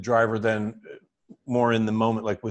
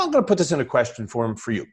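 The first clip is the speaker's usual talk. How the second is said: normal talking voice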